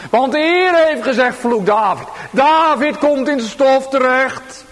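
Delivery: fervent preaching. Acted. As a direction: drive the story home. Preaching David's story. (vurig vertellende preek)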